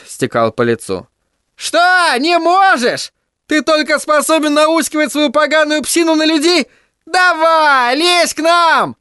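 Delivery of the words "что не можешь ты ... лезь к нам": высокий мальчишеский задиристый крик, медленно; задирай старика через забор; Тедди раззадорился. Acted. (Тедди задирает Майло, дразняще кричит)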